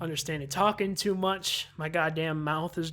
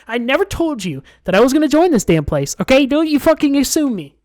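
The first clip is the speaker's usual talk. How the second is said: loud indignant rant; mock outrage; 5 semitones higher, 13 dB louder than usual. Real, not acted. Gamer protesting loudly at a character who assumed he joined. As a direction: protest hard that you never agreed to join. Player ranting at Monika's assumption.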